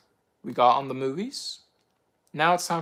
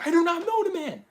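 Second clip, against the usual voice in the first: higher, strained repeat; repeated denial; 14 semitones higher than usual. Retold or imitated. Peter denying Jesus again, higher and strained. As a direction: push the denial higher, pleading. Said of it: "Peter was, like, saying".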